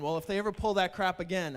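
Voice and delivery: ordinary talk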